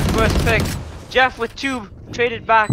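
rising excited play-by-play; esports caster. Acted. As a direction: call the retake fast and loud. Live play-by-play call.

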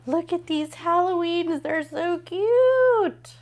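gasp, then a high, loud, slow "so cute"; gushing over cute Halloween stickers; excited gasp. Real, not acted.

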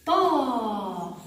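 طارق stretched out slowly; slow syllable demo. Shown as a demonstration. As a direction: drag طارق out to break it apart. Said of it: "نحلل سويًا". (تقطيع طارق ببطء)

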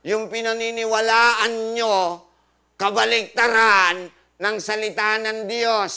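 loud, drawn-out declaration; emphatic proclamation. Real, not acted. Preacher confronts audience with their mistaken belief, emphatic proclamation. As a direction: proclaim it slowly and forcefully.

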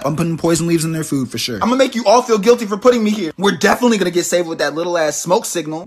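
ordinary talk

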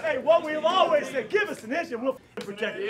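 loud, emphatic team speech; fired up. Real, not acted. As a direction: pump up the players.

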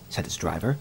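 plain reading voice

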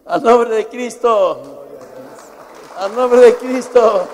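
booming pulpit declaration; fervent shout. Real, not acted. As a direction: cry it out to the whole church. Preacher's fervent proclamation.

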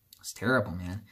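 breathy sigh, then a whispered, quick "it was terrible". Real, not acted. Telling viewers how the news hit him, whispered with a sigh. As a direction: let the grief leak out in a hushed whisper. Grieving over Stan Lee's death.

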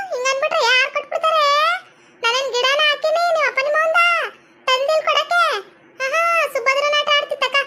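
high-pitched sarcastic telling-off; mocking scolding. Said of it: "ಹಂಗ್ ಬೈಬೇಡ ಕಣತೆ". (ವ್ಯಂಗ್ಯದಿಂದ ಬೈಯುವ ದನಿ)

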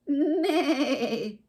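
high, wavering neigh falling away; whinny with a long wobble; horse whinny. A narrator's reading. Horse answering Duck in the farmyard, horse whinny. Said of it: "said Horse".

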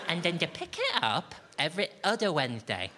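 mock-patient nursery voice for the housemates; sing-song voice for housemates. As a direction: talk down to them like a nursery teacher. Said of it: "Why are you talking like that".

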